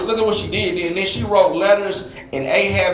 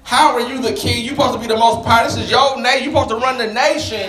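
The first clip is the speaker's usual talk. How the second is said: higher, insistent challenge; indignant berating; 4 semitones higher than usual. Acted. Wife berates the king for not using power, indignant. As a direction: lay into him in disbelief.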